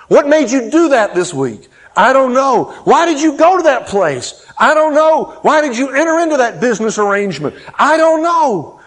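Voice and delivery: high, loud rapid-fire questions and shrugged answers; building exasperation. Retold or imitated. Preacher voicing questions put to the congregation, building exasperation.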